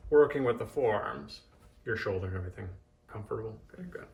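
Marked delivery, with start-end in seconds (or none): 1.9-4.1 softly checking in with client; quiet, gentle murmur during massage; soothing massage murmur. Real, not acted.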